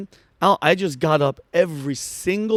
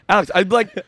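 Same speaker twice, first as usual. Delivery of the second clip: ordinary delivery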